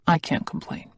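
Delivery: ordinary delivery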